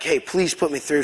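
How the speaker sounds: usual speaking voice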